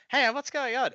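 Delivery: high, puzzled "what's going on"; a jump up in pitch, baffled at the error; confused surprise. Retold or imitated. Developer confused by the broken setup, high puzzled surprise. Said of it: "I'm like".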